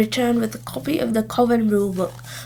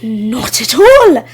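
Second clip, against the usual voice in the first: louder, slow and cross; crossly snapped back; 7 dB louder, slower than usual. A narrator's reading. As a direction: bite each word back at her. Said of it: "snapped back Sharkadder, even more crossly".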